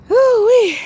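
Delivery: high, loud woo-wee; excited whoop. Real, not acted. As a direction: belt out a delighted woo-wee. Exclaiming on stream.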